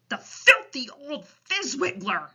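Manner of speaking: storybook giant's mild shout; indignant shout. A narrator's reading. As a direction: exclaim like an appalled giant. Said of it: "shouted the B F G".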